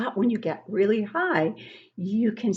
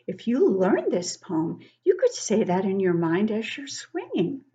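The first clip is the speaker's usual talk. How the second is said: ordinary talk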